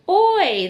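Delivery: the oi sound, said high and loud as "oy". Shown as a demonstration. Teacher modelling the oi sound for pupils. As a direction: sound out "oy" clearly. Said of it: "There's the oi sound on the end".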